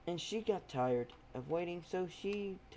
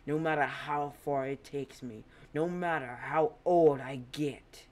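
vengeful threat; 5 dB louder than usual. Acted. Izuku warning his father's killer on camera, menacing. Vow of revenge to the world.

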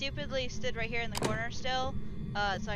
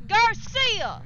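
loud, higher taunting yell; shouting; 10 semitones higher, 11 dB louder than usual. Acted. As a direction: scream insults to provoke her. Yelling to provoke the ghost.

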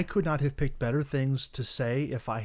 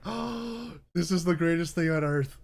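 delighted; 4 semitones higher, slower than usual. Retold or imitated. Retelling own delight.

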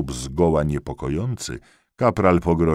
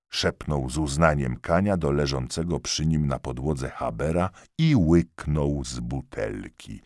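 plain reading voice

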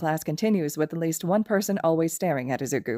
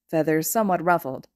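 plain reading voice

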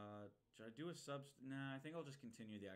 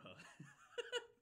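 breathy high laugh. Real, not acted. Laughing at the doll.

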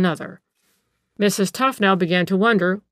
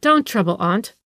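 ordinary delivery, nothing marked

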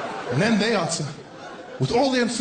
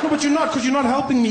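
exasperated caller; 6 semitones higher, faster than usual. Acted. Caller argues with agent.

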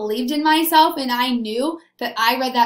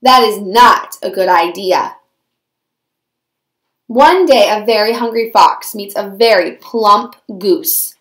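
loud, low storyteller voice; dramatic story narration; 3 semitones lower, 7 dB louder than usual. A narrator's reading. Narrator introduces hungry fox and plump goose, loud and dramatic. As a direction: narrate it like a trailer, punching key words.

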